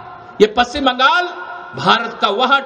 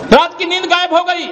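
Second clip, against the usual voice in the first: raised, projected rally cry; ringing repeat; 3 semitones higher, 3 dB louder than usual. Real, not acted. Speaker mocking the chief minister's lost sleep, raised and ringing. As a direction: fling the jibe out to the crowd.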